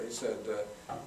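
ordinary delivery

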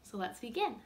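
usual speaking voice

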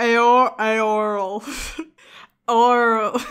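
"Eorl" tried out haltingly, with laughter; groping for pronunciation. Real, not acted. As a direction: sound out "Eorl" unsurely, stretching it. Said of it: "not knowing how to say".

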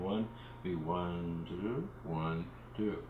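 slow, drawn-out counted beats; held note demo. Shown as a demonstration. Teacher demonstrating held beats, slow and drawn out. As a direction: show the doubled note length. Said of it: "instead of being one".

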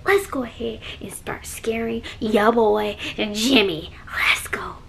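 very soft breathy whisper. Real, not acted. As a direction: whisper while sneaking. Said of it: "why I'm whispering".

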